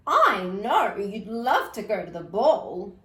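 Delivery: a different, loud fairy godmother. A narrator's reading. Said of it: "she said to the wolf".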